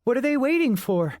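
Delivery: put-on a curious young wizard; young woman voice.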